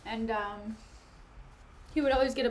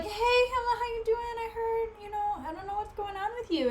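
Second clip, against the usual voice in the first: high-pitched mock-friendly voice, lightly sing-song; fawning friends; 11 semitones higher than usual. Rosencrantz and Guildenstern checking on Hamlet, high mock-friendly voice. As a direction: put on a high, falsely casual greeting.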